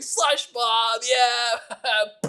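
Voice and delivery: high, gleeful cartoon bully taunt; a lightly voiced villain cheer. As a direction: crow it like a Toy Story bully. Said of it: "a bully villain from, like, Toy Story".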